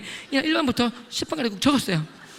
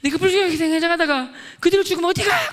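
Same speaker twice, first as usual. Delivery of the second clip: high, loud accusing yell; shouted interrogation; 6 semitones higher, 5 dB louder than usual. Retold or imitated. Confronting a classmate about dying unsaved, acted angry shout. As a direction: build it into a demanding yell.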